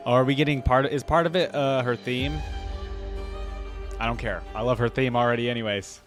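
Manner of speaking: ordinary delivery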